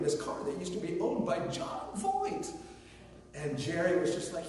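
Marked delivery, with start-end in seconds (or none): 0.0-2.5 rising into a high, giddy squeal; mock excitement. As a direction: gush it, climbing very high on the name. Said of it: "George was so excited".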